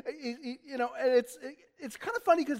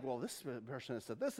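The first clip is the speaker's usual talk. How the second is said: low, quiet throwaway mumble; droning citation; 9 semitones lower, 11 dB quieter than usual. Retold or imitated. Preacher mimicking old teachers citing others, droning. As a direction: mutter a citation as a dull aside.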